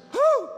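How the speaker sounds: sudden loud, high "whew"; mock thrilled whoop. Preacher mimicking a churchgoer with chills, high loud whoop.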